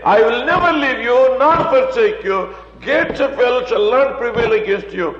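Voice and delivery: fervent preacher in full flow; preaching at full force. Acted. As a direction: drive the truth home to the congregation.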